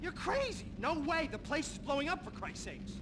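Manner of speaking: high frantic protest; panicked refusal. Acted.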